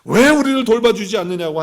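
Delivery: loud, high accusing outburst; acted angry telling-off; scolding shout. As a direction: scold the master indignantly. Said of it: "호통치면서 야단치듯이".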